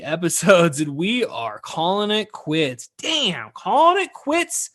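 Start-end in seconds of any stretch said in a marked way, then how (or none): 3.5-4.7 high falsetto, drawn out slowly; mock dismay. Host reacting to the podcast ending, mock dismay in falsetto. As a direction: squeal it in exaggerated disbelief.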